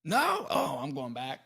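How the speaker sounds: playful surprise. Real, not acted. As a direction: react with playful astonishment. Playful reaction to answer.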